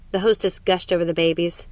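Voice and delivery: usual speaking voice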